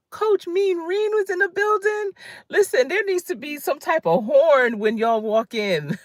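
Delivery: excited. Real, not acted. Spotting a fan in the chat.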